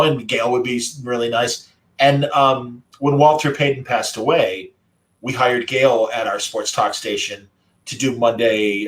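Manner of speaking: ordinary talk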